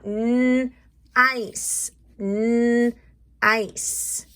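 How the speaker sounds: N-ice split into its sounds, n then ice; sounding out nice for the class; sounded out. Shown as a demonstration. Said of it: "really sound that out".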